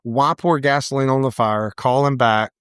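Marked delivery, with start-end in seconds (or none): none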